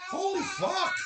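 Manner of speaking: high, excited exclamation. Real, not acted. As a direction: burst out in amazement. Excited at the map.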